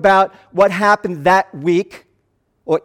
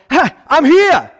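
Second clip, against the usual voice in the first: high, loud triumphant greeting; Jesus popping up behind them, cheering; excited shout; 7 semitones higher, 5 dB louder, slower than usual. Pretend. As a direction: burst in like a home-run hero. Jesus voiced as triumphant friend.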